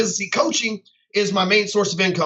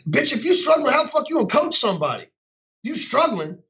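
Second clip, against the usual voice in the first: raised pitch, heated and scornful; heated rant, voice pitched up; angry rant; 5 semitones higher than usual. Real, not acted. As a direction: tear into fake coaches. Ranting at fake coaches.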